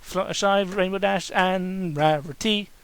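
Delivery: ordinary delivery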